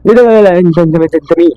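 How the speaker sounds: high, panicked stammer; stumbling over words in fright; startled fear. Acted. Hossam startled by Abdo on the stairs: high panicked stammer.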